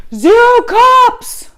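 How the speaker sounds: slow, very loud, high jeering bro shout; mock the rowdy fan's chant; imitated bro yell. Rowdy Penguins fan leaning out the window, jeering mock shout. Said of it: "he leans out his window and goes".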